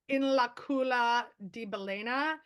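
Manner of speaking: "in la culla di balena" drawn out slowly in Italian; Italian phrase, slow. Shown as a demonstration. Teaching the host the Italian curse, slow and careful. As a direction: say "in la culla di balena" slowly, savouring each syllable. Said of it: "is, uh".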